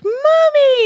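very high, yelping puppy cry; little dog voice. Retold or imitated. A Jack Russell locked in the truck calls for his owner, very high yelp. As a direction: squeal it like a tiny Jack Russell. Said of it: "he's a little Jack Russell and he's like".

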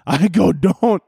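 laughing. Real, not acted. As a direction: start the retort and crack up. Cracking up mid-retort.

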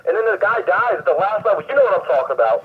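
high-pitched mock customer grasping for details; clueless customer. Acting out customer vaguely describing game.

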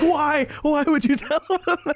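very high, laughing exasperation; exasperated outcry. Acted. Asking a teammate why he told them, exasperated. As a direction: wail it in comic disbelief. Exasperated character reacts, arms up.

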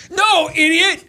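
high, loud exasperated yell; angry customer yelling at a fast-food clerk; ticked-off customer. Retold or imitated. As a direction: snap at the clueless clerk. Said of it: "he's like".

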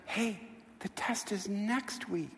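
very soft, slow, consoling; hushed gentle reveal. Retold or imitated. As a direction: break it to them gently, barely above a murmur. Teacher gently tells crying student.

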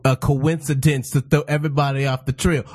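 ordinary talk

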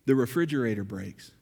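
normal talking voice

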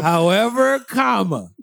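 playful mock suspense; mock dramatic announcement. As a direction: announce it like a grand reveal. Spells out punctuation for drama.

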